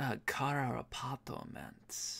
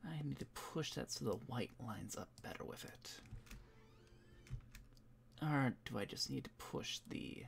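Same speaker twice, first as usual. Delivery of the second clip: whispering to himself; 8 dB quieter than usual. Real, not acted. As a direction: murmur under your breath as you work. Mutters to himself while editing.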